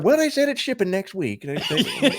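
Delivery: put-on high-pitched elderly collector voice; put-on high old man voice with laughter around it. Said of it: "He's like".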